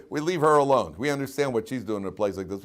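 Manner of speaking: ordinary delivery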